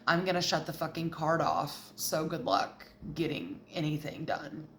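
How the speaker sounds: low, quiet controlling partner; controlling Leo. Acted. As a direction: lay down the law softly and low. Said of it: "like, very controlling".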